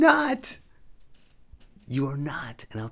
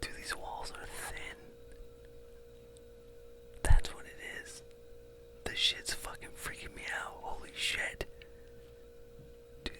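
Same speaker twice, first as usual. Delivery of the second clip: scared whisper; 11 semitones lower, 10 dB quieter than usual. Real, not acted. Spooked by footsteps in a shady hotel, scared whisper. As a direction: whisper it like something's outside the door.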